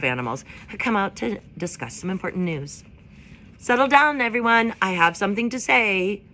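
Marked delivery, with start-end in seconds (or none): 3.6-6.2 higher, louder owl addressing a crowd; hooting owl. A narrator's reading. Owl calling the meadow crowd to order, higher and louder. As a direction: hoot it out from the branch. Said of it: "Swooper the owl hooted to the crowd".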